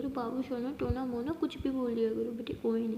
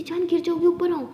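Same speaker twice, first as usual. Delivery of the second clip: loud, higher anxious plea; worried call; 5 semitones higher, 8 dB louder than usual. Acted. Aliya frightened Maya will fall, calling out loudly. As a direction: call out in alarm to pull her back.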